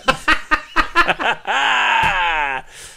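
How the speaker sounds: loud burst of laughter. Real, not acted. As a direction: crack up loudly. Big laugh.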